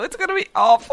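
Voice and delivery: high-pitched protest; playful dismay. Real, not acted. Fan dreading the Cockney accent, high dismay. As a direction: wail it in mock despair.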